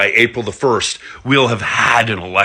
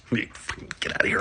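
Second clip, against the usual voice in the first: whisper; 12 dB quieter than usual. Acted. Joking dismissal, whispered aside.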